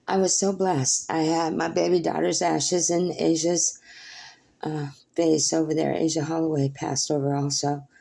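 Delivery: ordinary delivery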